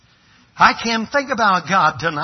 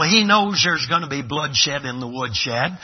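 ordinary delivery

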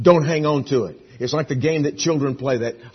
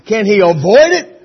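louder, higher, incredulous question; mock exasperation; 7 semitones higher, 12 dB louder than usual. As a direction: playfully protest to the pilot. Mock plea to the pilot.

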